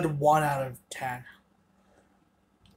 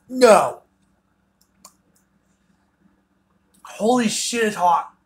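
pained loud reaction; 6 semitones higher, 6 dB louder than usual. Real, not acted. Burning mouth reaction.

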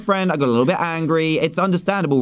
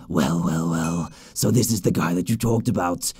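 low, menacing villain, softer; 11 semitones lower, 5 dB quieter than usual. Acted. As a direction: gloat like a smug villain. Villain voiced in game cutscene.

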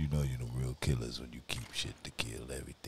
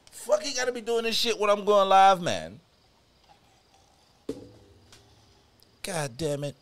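loud, high angry shout; frustrated yell; 18 dB louder than usual. Real, not acted. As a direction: yell at the screen. Frustrated yelling at game.

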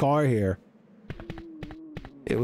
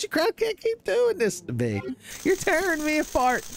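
very high, hammed-up wail; melodramatic anguish. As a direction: wail it far above your usual pitch, overacting. Melodramatic film quote at the enemies.

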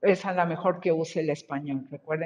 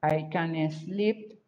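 slow model; 3 semitones lower, 5 dB quieter, much slower than usual. Shown as a demonstration. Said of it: "teacher models student's sentence".

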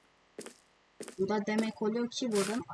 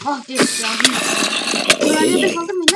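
startled yell; 4 semitones higher, 10 dB louder than usual. Real, not acted. Nearly dies in game.

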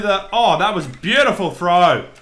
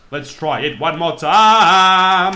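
"Let's try it one more time" stretched slow and louder; slow loud drawl; 7 dB louder, much slower than usual. Real, not acted. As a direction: drag out each word, pumped up. Drawn out loud repeat.

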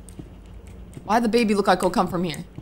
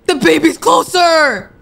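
alarmed cry; 5 semitones higher, 5 dB louder, slower than usual. Real, not acted. Gamer warning viewers the baby is closer, alarmed. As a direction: sound the alarm as it gets closer.

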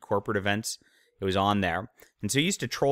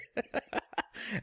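high breathy laugh; 13 semitones higher, 9 dB quieter than usual. Real, not acted. Mocking Wheaton's story.

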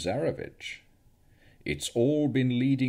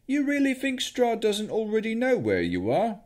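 put-on much higher, innocent-sounding young man; cheeky young wizard; 14 semitones higher, 4 dB louder than usual. A narrator's reading. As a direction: play it cheeky, all innocence. Victor's cheeky retort.

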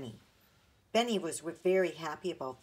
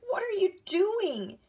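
much higher, louder and slow; shocked cry; 13 semitones higher, 8 dB louder, slower than usual. A narrator's reading. Big sister crying out at Benny's haircut on the dog. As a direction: demand it in startled disbelief. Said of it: "she cried".

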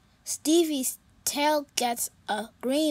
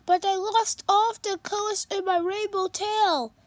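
a touch higher, quick unicorn voice; lightly voiced sad unicorn; 7 semitones higher, 3 dB louder, faster than usual. Stevie telling Pete about the party, lightly worried.

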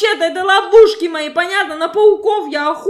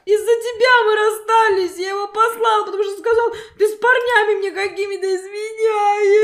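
higher, heated shouting blame; angry blame; 5 semitones higher than usual. Acted. Bride blames the driver for her breakup, high heated shouting. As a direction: throw all the blame at him. Crash victims quarrel, blaming.

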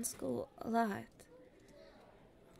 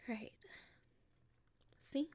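normal talking voice

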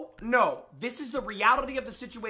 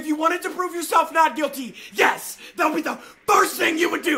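raised, higher shouting voice hammering the point; heated shout at a cheating opponent; 6 semitones higher, 6 dB louder than usual. Real, not acted. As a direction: yell it at him in the argument. Heated argument over hand cam.

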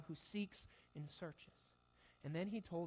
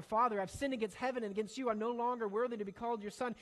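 louder, higher, pressing plea; pleading apology; 4 semitones higher, 7 dB louder, faster than usual. Retold or imitated. Son pouring out his apology to his father, loud and higher. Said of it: "He's going into that pre-planned apology".

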